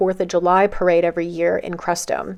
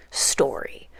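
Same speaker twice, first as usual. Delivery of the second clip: whispered aside; 6 semitones lower, 8 dB quieter than usual. Acted. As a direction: drop to a breathy whisper on the last word. Dramatic aside about ego's promises.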